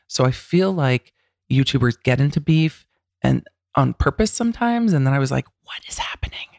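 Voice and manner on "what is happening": whisper. Retold or imitated. Whispered shocked reaction.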